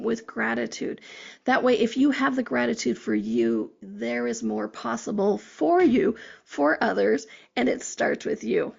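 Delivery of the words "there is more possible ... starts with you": ordinary talk, nothing marked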